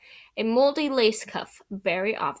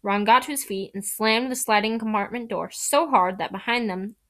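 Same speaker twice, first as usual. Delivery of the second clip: plain reading voice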